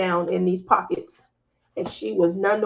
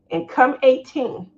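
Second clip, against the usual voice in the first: ordinary talk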